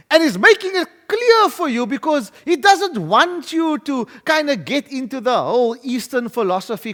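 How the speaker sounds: ordinary talk, nothing marked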